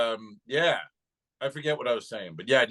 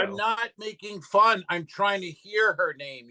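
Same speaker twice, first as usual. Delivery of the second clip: higher, drawn-out insistence; exasperated protest; 6 semitones higher than usual. Retold or imitated. Comic defending himself to the Karen, exasperated protest. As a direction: push back slowly at a higher pitch, acting fed up.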